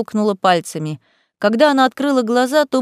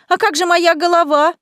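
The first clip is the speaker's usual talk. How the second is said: high, indignant wail; wailing protest; 9 semitones higher, 3 dB louder than usual. A narrator's reading. Мыриня begs about her bewitched head, wailing. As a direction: wail it out in high-pitched alarm. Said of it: "завопила Мыриня".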